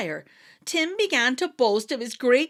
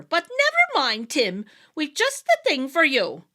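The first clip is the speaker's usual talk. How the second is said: much higher, louder reassuring wee-folk voice; cheery fairy; 6 semitones higher, 6 dB louder than usual. A narrator's reading. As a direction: perk him up with a treat. Fairy leader consoling.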